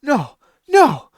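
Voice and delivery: a hammed-up horrified no; mock panic. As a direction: pretend to panic as the hand appears. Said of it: "you're like".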